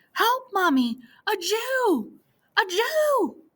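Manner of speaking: put-on high panicked child's cry; children screaming. Children fleeing to their mothers, high panicked cries. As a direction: run home crying for mother.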